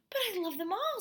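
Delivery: put-on whiny child. A narrator's reading. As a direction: wail it like a boy losing his toys. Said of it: "Spencer cried".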